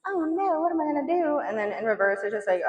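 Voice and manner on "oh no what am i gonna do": high mock-damsel whine; mock helpless. Voicing the Eight of Swords woman, high mock despair. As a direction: ham up the helpless woman in the card.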